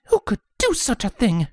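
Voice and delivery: a lady barely holding her fury; Rarity, indignant. Acted. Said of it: "Rarity hissed".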